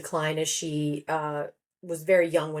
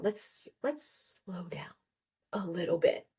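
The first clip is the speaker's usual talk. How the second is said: slow, soft whisper; hushed slowing down; 7 dB quieter, slower than usual. Shown as a demonstration. Author urging everyone to slow down, slow hushed whisper. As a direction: whisper it slowly, as if easing someone down.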